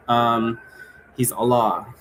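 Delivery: normal talking voice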